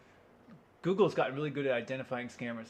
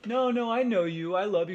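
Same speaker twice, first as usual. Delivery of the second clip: insistent adoring plea; 7 semitones higher, 6 dB louder than usual. Acted. Admirer to the loner, insistently adoring. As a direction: plead high and loud, like a clingy admirer.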